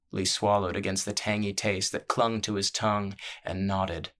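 usual speaking voice

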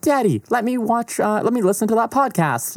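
put-on high-pitched pleading child voice; put-on kid voice, way up high; little kid. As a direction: play an excited little boy asking his dad. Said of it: "little Jimmy".

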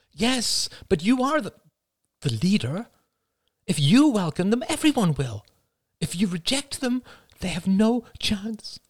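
mother urging forgiveness; pleading mother. A narrator's reading. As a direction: beg for mercy for family. Mother voiced high.

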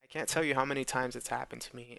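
ordinary talk, nothing marked

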